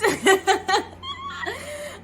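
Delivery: high, giddy burst of laughter; high giggle. Real, not acted. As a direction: laugh at yourself for getting lost. Laughing at getting lost.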